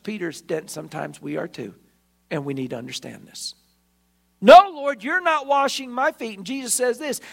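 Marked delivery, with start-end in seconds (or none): 4.4-6.4 a sharp, loud no, then higher insistence; loud refusal. Acted. Peter refusing Jesus at the basin, loud and stubborn. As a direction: bark the no at him.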